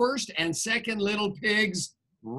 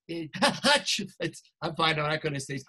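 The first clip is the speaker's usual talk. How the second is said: plain reading voice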